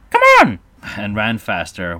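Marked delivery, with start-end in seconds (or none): 0.1-0.6 short, very high, loud squawk; Gryphon. Acted. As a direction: squawk it as an urgent order. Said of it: "the Gryphon only answered".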